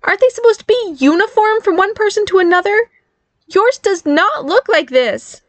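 high, squeaky indignation, slowing at the end; ranting. A narrator's reading. Barry compares his uniform with mother's, ranting. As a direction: build the complaint to an emphatic finish. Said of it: "Barry ranted".

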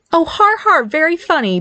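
high-pitched mock laugh, sour; sarcastic glowering. A narrator's reading. Barry glowers at snickering brother and mother, sarcastic. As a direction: fake the laugh, unamused. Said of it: "Barry glowered between Nick and his mother".

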